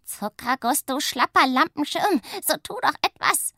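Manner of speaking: high, urgent calling; panicked shout. Acted. Witch racing at the trees yells to her sister, panicked. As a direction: beg for help while scolding.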